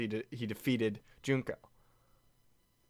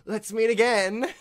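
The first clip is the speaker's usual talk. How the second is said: mock parting line; 8 semitones higher, 6 dB louder than usual. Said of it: "He's like".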